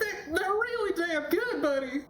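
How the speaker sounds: high teary voice; mock crying. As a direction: blubber it, laughing through tears. [name] crying along.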